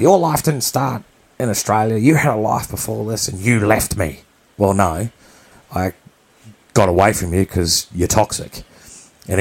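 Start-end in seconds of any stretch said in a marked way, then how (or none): 0.0-4.2 higher, half-acted blame from the mother; accusing mother. Mother on the phone accusing her son of leaving, reproachful. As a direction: throw the old desertion back at him, reproachful.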